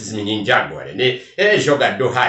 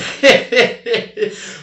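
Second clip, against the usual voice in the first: high, falling laugh at his own aside; 10 semitones higher than usual. Real, not acted. Laughing off his own cheeky aside to listeners. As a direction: laugh off the digression.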